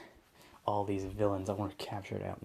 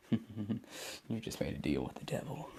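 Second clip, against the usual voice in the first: sinister villain line; 4 dB quieter than usual. Acted. Deku after tricking Shigaraki.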